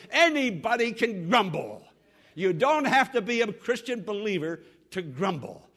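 loud, emphatic pulpit delivery; forceful preaching. Real, not acted. Preacher warning believers against grumbling, forceful preaching. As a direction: drive the point at the congregation.

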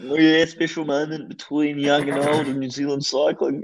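slow, sappy mock broadcast voice; lightly voiced commentator. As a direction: lay it on like a TV commentator. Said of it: "The commentator's going".

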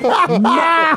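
high, drawn-out mock shout; slow-motion shout. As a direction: stretch it out like a slow-motion movie cry. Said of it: "it's like slow motion".

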